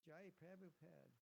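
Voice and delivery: mock Australian accent with flat "eh" vowels. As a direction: imitate an Australian chanting, a's turned to eh. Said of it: "with that accent".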